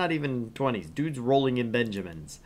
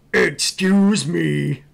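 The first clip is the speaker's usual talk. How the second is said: loud, very slow stretched demand; loud indignant; 6 semitones higher, 11 dB louder, much slower than usual. Acted. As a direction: draw it out with indignation. Angry customer interjects loudly.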